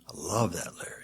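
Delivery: breathy unvoiced whisper of love for the song. Real, not acted. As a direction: hush it like a secret. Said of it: "whispered aside".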